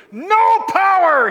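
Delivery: NO POWER loud, high and drawn out; emphatic. Acted. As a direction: punch out both words of "No power" slowly, high and loud. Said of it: "Paul says it emphatically".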